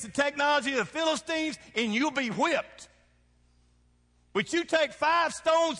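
high, loud, slow shouted preaching; a fiery Southern preacher in full cry; full-force preaching. Acted. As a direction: thunder it from the pulpit.

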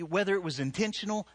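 ordinary talk

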